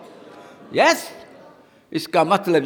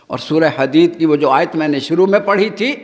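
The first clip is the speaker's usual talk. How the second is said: normal talking voice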